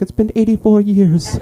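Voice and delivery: put-on high-pitched old lady voice; wistful elderly film heroine imitation; Rose from the Titanic. Elderly lady lifted like a man after years, reminiscing. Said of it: "she reminded me of Rose from the Titanic".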